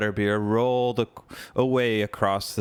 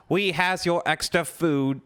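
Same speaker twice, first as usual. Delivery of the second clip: squeaking elf; 7 semitones higher than usual. Acted. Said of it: "squeaked an elf".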